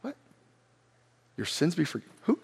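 hushed, furtive murmuring among onlookers; whispering. Acted. Scribes start talking among themselves about forgiven sins, whispering. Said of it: "They start talking, whisper".

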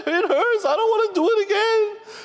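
high-pitched wailing child, hammed up; exaggerated pretend sobbing in a squeaky high voice; mock crying. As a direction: put on a kid's tearful whine, very high. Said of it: "and he's all crying. He's like".

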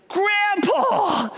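put-on be the four-year-old spotting grandpa; child's joyful cry. Little grandson running to greet grandpa, high joyful child cry.

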